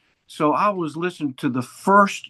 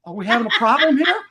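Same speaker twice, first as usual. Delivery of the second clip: fast, laughing imitation of a nervous father; worried dad; 8 semitones higher, 4 dB louder, faster than usual. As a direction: play the uneasy dad, laughing. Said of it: "my old man was going".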